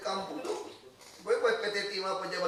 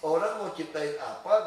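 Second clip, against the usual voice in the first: usual speaking voice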